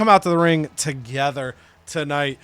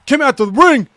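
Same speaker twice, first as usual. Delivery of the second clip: loud, much higher, shouted wrestling promo; over-the-top shouted wrestler promo; Scott Steiner promo; 8 semitones higher, 9 dB louder than usual. Retold or imitated. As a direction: yell it like an unhinged heel on the mic. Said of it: "Scott Steiner comes out and says".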